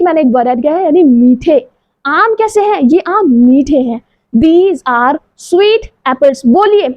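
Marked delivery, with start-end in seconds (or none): 4.4-6.5 "These are sweet apples" very slow with pauses; pause between words. Shown as a demonstration. Teacher modeling with pauses, very slow. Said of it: "pause कीजिए".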